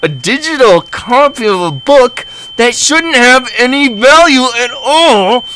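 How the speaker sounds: hammed-up high-pitched shouting; mocking outraged yell. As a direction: escalate the quote into furious mockery. Mocking the clerk's refusal in outrage.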